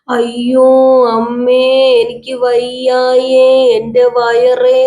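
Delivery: slow, drawn-out moaning cry, acted; mock pain wail. A narrator's reading. Mother crying out with sudden stomach ache, drawn-out mock wail. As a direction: wail each word out long, hamming up the bellyache. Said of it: "അങ്ങോട്ട് കാറി കൂവി വിളിക്കുകയാണ്".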